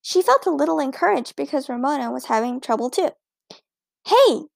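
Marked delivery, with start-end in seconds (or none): 4.0-4.5 high, loud "Hey!"; a boy's loud protest; loud interruption. A narrator's reading. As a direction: cut in sharply and loudly. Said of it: "interrupted Wayne in a loud voice".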